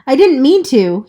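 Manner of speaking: blurted out. A narrator's reading. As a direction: let it burst out at last. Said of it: "he finally blurted out".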